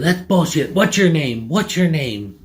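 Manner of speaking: high, demanding mock anger; imitated heated interrogation; irate customer. Customer demanding his name in quarrel.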